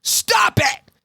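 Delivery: high, explosive shout; hitting her with it. Retold or imitated. Psychiatrist cutting off patient's what-ifs, high explosive shout. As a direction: cut her off with a yell. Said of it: "Bob just hits her with".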